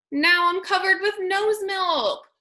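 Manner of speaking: hammed-up disgusted school; disgusted complaint. A narrator's reading. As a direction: complain about the mess, grossed out. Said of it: "thought the school".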